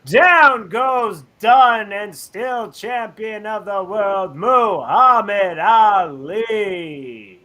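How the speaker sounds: slow, stretched-out fight call; boxing announcer. Acted. Announcer calls Ali's title win in Munich, drawn-out fight call. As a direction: draw out every name like a ring announcer.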